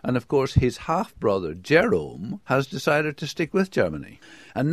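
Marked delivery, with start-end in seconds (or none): none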